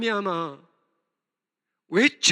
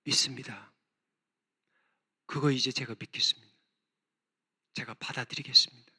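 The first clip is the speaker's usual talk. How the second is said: lower, softer voice of yielding faith; Habakkuk surrendering; 10 semitones lower, 6 dB quieter than usual. Acted. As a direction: give in quietly as the prophet. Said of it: "하박국이 뭐라고 하는 줄 알아요?". (낮고 조용히 순종하는 하박국)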